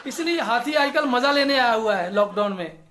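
normal talking voice

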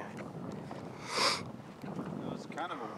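breathy whisper aside. Real, not acted. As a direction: whisper it under your breath. Quiet aside about knee.

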